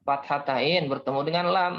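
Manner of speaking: ordinary talk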